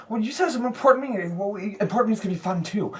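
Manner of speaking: put-on high, very fast child's backpedal; quick high kid chatter; arguing kid. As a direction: reason it out in a rush. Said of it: "so he goes".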